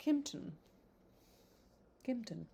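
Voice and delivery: Kimpton with a short i, "KIMP-ton"; Kimpton, short i, tried twice; practising British pronunciation. Shown as a demonstration. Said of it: "British pronunciation".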